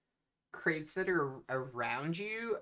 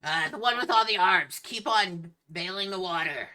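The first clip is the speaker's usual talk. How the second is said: loud captain voice, pitched up; captain's shouted order; 5 semitones higher, 10 dB louder than usual. Acted. Captain telling the many-armed crewman to keep bailing, shouted. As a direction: holler orders at the crew.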